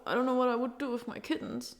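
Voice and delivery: choked-up talk about who keeps the kittens; tearful. Real, not acted. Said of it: "I haven't tasted a tear in such a long time".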